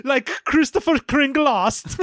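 put-on high, slow German accent. German Santa naming himself, slow mock German accent. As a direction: draw it out in hammy German. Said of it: "try out my German accent".

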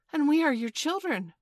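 put-on eager children calling out together; crying out. A narrator's reading. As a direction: pipe up high and loud. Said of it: "cried the twins".

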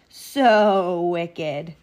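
villainous self-congratulation; gloating wolf. A narrator's reading. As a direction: revel in being wicked. Wolf gloats after eating girl.

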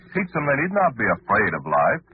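usual speaking voice

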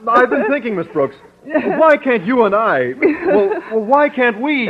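high, halting, stammered; can't get the words out; nervous proposal. Acted. A shy man fumbling toward proposing to Miss Brooks.

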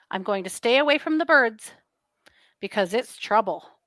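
normal talking voice

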